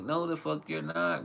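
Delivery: retold parental refusal. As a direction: shut the kid down, half-joking. Retells refusing his son.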